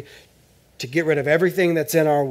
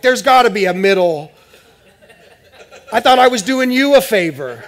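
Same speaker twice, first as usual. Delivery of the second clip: mock protest; 6 semitones higher, 10 dB louder than usual. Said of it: "I'm like".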